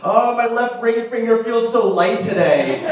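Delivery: hammed-up lovesick longing, drawn out; mock wistful sigh. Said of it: "be like".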